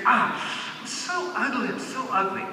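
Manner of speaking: high, soft mock lament; lightly voiced woman moaning about her looks; self-pitying mother. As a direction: sigh it out like she hates her reflection. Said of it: "she sees the mirror and she goes".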